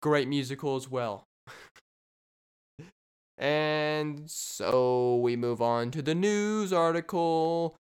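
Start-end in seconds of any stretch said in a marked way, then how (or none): 3.4-7.8 announcer intro. Real, not acted. Segment announcement.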